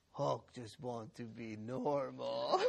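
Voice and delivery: slow, mock-sorrowful monster voice; drawn-out lament of a comic brute; Hulk. Boy answering his parents, forlorn Hulk voice. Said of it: "And he says".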